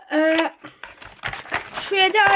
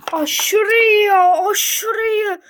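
excited; 4 semitones higher, 4 dB louder, much slower than usual. Real, not acted. Thrilled by the unboxed Camaro.

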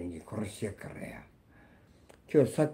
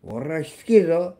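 threatening growl-shout, higher and louder; menacing; 6 semitones higher, 5 dB louder than usual. Real, not acted. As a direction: warn them with real menace. Threatening betrayers. (脅すような怒声)

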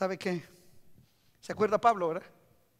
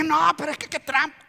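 protesting; 5 semitones higher, 5 dB louder than usual. Acted. Acted player cries foul.

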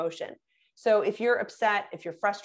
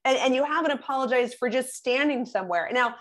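normal talking voice

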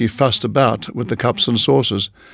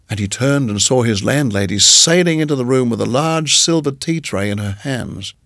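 plain reading voice

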